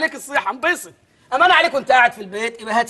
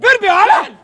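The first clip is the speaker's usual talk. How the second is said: mocking outrage; 8 semitones higher, 5 dB louder than usual. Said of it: "مدرس لغه عربيه ولا لغه انجليزيه".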